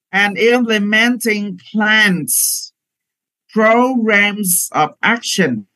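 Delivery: slow, careful phrase-by-phrase reading aloud; slow model reading. Shown as a demonstration. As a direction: read it slowly in short chunks for students. Teacher reads passage as model. (giáo viên đọc mẫu chậm từng cụm)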